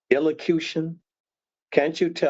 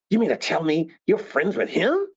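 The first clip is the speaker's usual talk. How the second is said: raised, accusing question; disapproving demand; 6 semitones higher than usual. Shown as a demonstration. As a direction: let them know you won't accept it. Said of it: "what you will not accept".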